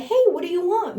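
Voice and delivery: put-on high, cheerful question to baby; bright question. Shown as a demonstration. Parent asking baby what they want, bright and high. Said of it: "Be like".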